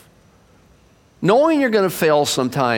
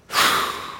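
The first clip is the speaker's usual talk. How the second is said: quiet, breathy sigh of giving up; soft sigh; 5 dB quieter than usual. Acted. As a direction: let the air out in defeat. God's sigh before refusing.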